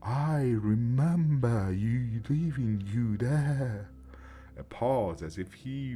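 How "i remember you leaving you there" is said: put-on very slow remorseful old man; confessing old man. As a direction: admit it slowly, weighed down. Grandpa confesses to ghost.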